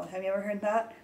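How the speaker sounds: usual speaking voice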